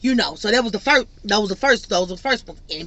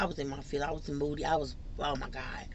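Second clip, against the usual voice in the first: much softer and lower; low and moody; 7 semitones lower, 15 dB quieter, faster than usual. Real, not acted. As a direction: sink into a sulky murmur. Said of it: "describing her moodiness".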